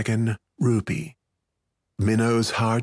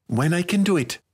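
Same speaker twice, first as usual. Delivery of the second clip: put-on higher-pitched, firm girlish answer; 7 semitones higher than usual. A narrator's reading. Girl answering her demanding friend, firm. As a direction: put your foot down as a young girl. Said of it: "said Minnow firmly".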